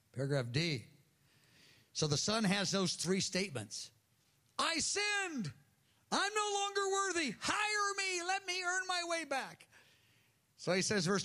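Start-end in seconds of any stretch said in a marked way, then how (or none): 4.6-9.7 very high, strained cry of confession; hammed-up desperate begging, far above the usual pitch; pleading son. Prodigal son begs his father.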